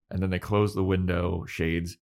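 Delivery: normal talking voice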